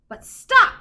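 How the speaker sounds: sudden high, loud halt; emphatic storyteller's stop; dramatic stop. A narrator's reading. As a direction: slam on the brakes with the voice. Dramatic halt in read-aloud.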